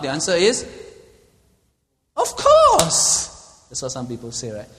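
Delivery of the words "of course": high, loud, breezy "of course"; mocking high "of course". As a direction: toss it off like it's obvious. Voices careless Christians.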